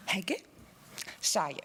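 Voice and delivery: Hege lifted at the end, long pause, then falling Shaye; carefully practised pronunciation. Shown as a demonstration. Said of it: "one more time have to say".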